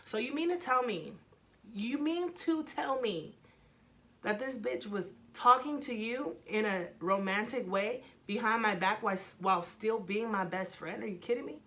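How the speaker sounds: acting out an outraged girlfriend's accusation; indignant disbelief. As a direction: demand an answer as if you can't believe it. Acted confrontation with cheating boyfriend.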